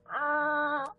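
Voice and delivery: high-pitched chuckle trailing on; real laugh. Real, not acted. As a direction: laugh at your own silliness. Laughing after her accent bit.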